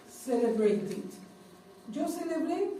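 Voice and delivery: ordinary talk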